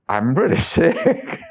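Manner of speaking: a laugh breaking into the word; amused at his own misery. Real, not acted. Monk laughing while recalling being very sick.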